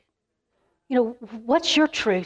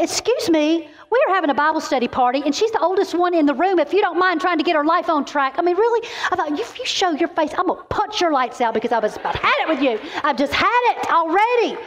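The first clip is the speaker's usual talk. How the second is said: higher, indignant scolding of the devil; mock indignation; 6 semitones higher than usual. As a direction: tell the devil off with mock outrage. Angry at the enemy.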